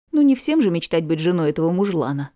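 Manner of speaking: usual speaking voice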